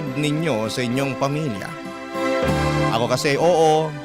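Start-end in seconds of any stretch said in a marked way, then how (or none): none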